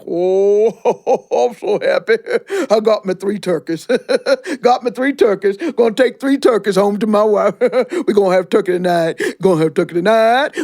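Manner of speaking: laughing happy bear; chuckling bear. Acted. Brer Bear gloating over his turkeys, laughing. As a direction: chuckle through the words, delighted.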